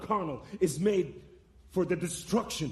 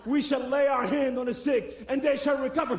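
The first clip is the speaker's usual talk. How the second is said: preaching proclamation; 6 semitones higher than usual. Retold or imitated. Acts out praying over man.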